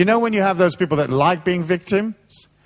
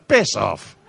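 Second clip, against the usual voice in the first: mock brush-off; 7 semitones lower than usual. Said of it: "tell him".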